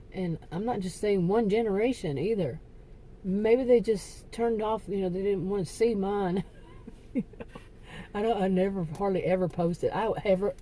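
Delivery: normal talking voice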